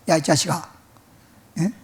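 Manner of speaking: usual speaking voice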